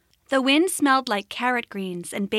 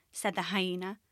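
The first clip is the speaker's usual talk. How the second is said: ordinary delivery, nothing marked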